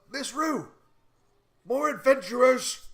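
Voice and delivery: high, loud yell announcing visitors; bodyguard yell. Acted. Bodyguards announce visitors to Morrigan Rue, yelling. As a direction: holler it out like a guard calling through the place. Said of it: "and they yell".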